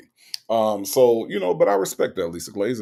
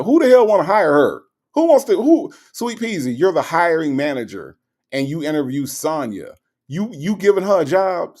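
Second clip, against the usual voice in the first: incredulous; 6 semitones higher, 5 dB louder than usual. Real, not acted. Incredulous about the movie character.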